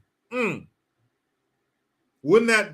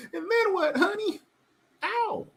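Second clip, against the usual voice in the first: very high, thin falsetto mockery; mocking falsetto squeak with a pained ow. Mocking voice in bedroom scene.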